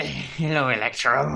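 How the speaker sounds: light German accent, a hint of unhinged doctor; German villain accent. Friend imitating the German Doctor Kafka, a light German accent. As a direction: greet him like a mad German doctor, lightly. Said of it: "he's German and he looks insane".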